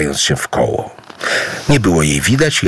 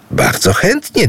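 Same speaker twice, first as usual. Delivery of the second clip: high, eager cry of yes; eager exclamation; 13 semitones higher than usual. Acted. Idu eagerly agrees to marry the jinn, exclaiming. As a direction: blurt it out happily. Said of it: "wykrzyknął Idu".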